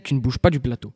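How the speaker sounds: ordinary talk, nothing marked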